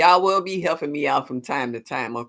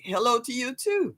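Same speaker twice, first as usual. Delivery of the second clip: ordinary talk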